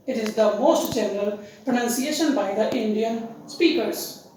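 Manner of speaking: usual speaking voice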